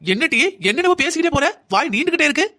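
indignant scolding. Acted. Mother-in-law scolds back. (மருமகளைக் கண்டிக்கும் மாமியார், உயர்ந்த குரலில் கோபமாக)

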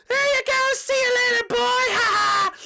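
high, loud victory yell; triumphant gloating. Acted. Peach finishing off a fan, gloating shout. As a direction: crow over the kill.

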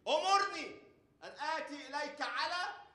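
Peter's plea cried out high and loud; fiery preaching. Acted. Preacher shouting Peter's plea to the crowd, high and loud. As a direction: hammer the words command me.

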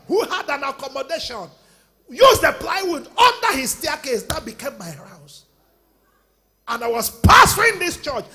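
shouting, stretched-out pulpit testimony; a pastor shouting his testimony at the church, fiery preaching. Acted. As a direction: preach it hard to the congregation, full volume. Pastor preaching at full force.

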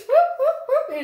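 high, loud hype whoops; excited whoop. Real, not acted. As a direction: cheer it like a hype intro. Hype intro chant.

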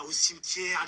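ordinary talk

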